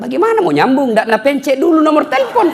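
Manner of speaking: high-pitched retold scolding; mock exasperation. Helper telling villager to dial first, mock exasperation. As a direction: correct him in comic disbelief.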